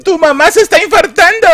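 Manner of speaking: very high, hammed-up girlfriend voice; mock-hysterical high wail; panicking girlfriend. Girlfriend telling boyfriend his mom's dying, mock hysteria. Girlfriend announces mother's heart attack.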